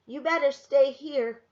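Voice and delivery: put-on a boy telling his sister to stay put; lightly voiced boy. A narrator's reading. Samuel telling Esther to stay, lightly voiced boy.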